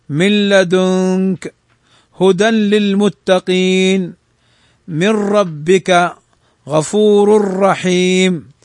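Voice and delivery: slow chanted recitation, the nun merged into the next letter with no nasal hum; slow Quran recitation. Shown as a demonstration. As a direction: recite each example slowly, merging without nasalization. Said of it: "فإنهما يدغمان بلا غنة".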